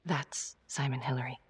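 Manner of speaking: whispered, soft and low; hushed reveal. Acted. Detective reveals the real Simon to her partner, whispered. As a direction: breathe the name like a secret. Revealing suspect's true identity.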